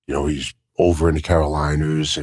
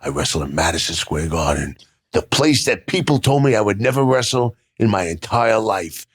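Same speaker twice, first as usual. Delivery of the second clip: usual speaking voice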